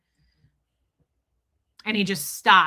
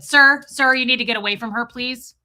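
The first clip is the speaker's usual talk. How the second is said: security guard; 2 semitones higher, 7 dB louder than usual. Retold or imitated. Security guard ordering him away.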